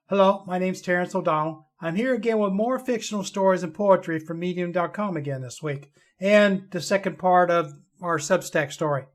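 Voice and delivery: ordinary delivery, nothing marked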